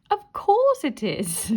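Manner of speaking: high, loud, slow giggly reply; giggling. A narrator's reading. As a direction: let the giggle finally burst through. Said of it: "she giggled".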